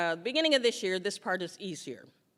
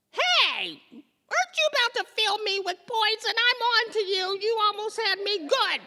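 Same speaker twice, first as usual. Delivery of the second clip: high, louder, knowing voice that catches on and laughs; suspicious body; 8 dB louder than usual. Acted. Her body to the doctor, a high, smug, laughing callout. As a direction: play the body as a smug know-it-all.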